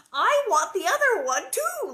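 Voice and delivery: high, loud hammed-up wail; loud sobbing. A narrator's reading. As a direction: wail like a child in a tantrum. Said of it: "Laura sobbed loudly".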